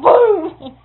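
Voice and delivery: loud mock gunshot with a laugh; a loud laughing boom. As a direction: blurt out a gunshot sound. Gunshot in a retold story.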